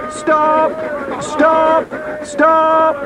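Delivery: very high-pitched pursuer's shout. Acted. Pursuers shouting after the runaway train, high-pitched yell. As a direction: cry out each word high and long, well apart. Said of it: "all of them shouting the same thing".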